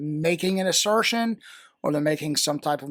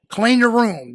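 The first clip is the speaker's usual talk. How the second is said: put-on sharp loud order to the kids, pitched up; parent's command; 7 semitones higher, 8 dB louder than usual. Shown as a demonstration. Mother ordering her kids to tidy up, loud command. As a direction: bark it like a fed-up parent.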